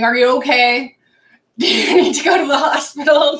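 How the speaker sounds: loud, laughing imitation of an alarmed mom; mom's concern, retold. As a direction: raise your voice, laughing through the fussing. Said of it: "as my mom's going".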